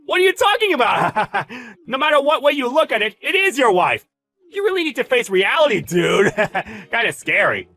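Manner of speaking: high, loud mocking insistence; cackling, gloating ridicule; derisive laughter. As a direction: jeer at his denial, laughing hard. Boss ridicules the denial, laughing.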